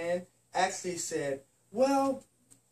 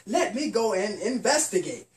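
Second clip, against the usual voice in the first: ordinary delivery, nothing marked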